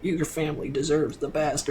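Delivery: mock vocal fry commercial read; vocal fry ad woman. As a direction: purr it like a cozy soup commercial. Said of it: "the woman's got vocal fry".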